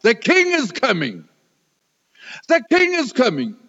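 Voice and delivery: high, excited English exclamation; excited retelling. Retold or imitated. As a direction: imitate her thrilled announcement, repeated with rising urgency. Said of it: "das sagte sie auf Englisch".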